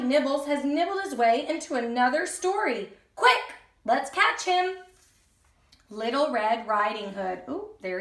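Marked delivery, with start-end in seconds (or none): none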